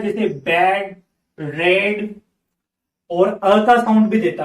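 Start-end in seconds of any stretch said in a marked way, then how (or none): 0.0-2.1 बैड and रेड said with a short ए vowel; teacher demo of बैड, रेड with an ए vowel; short e sound. Shown as a demonstration. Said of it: "ए का sound भी देता है".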